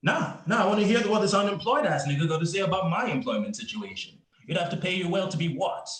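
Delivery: high-pitched, affronted demand; indignant challenge. Acted. Cop challenging unemployed man about pay remark, indignant challenge. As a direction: dare him to finish, voice riding high.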